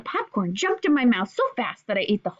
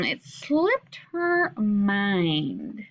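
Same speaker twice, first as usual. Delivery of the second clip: slow thoughtful repeat; 3 semitones lower, much slower than usual. Shown as a demonstration. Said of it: "it's an idiom".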